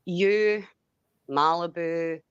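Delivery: usual speaking voice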